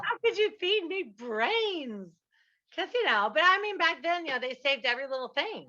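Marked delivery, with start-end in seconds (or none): 0.0-2.1 mock betrayed child. Reliving childhood betrayal by grandma.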